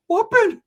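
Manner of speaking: high yelped whoop; a short celebratory whoop; mock whoop. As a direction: whoop it out gleefully. Mock whoop leaving for grandma's.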